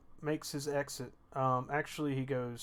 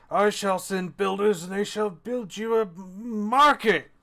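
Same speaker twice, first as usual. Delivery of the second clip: put-on slow, loud drunken royal decree; grand proclamation; 4 semitones higher, 6 dB louder, slower than usual. Drunk dwarf king promising the guests a market, slow and loud.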